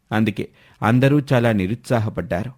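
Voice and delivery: ordinary delivery, nothing marked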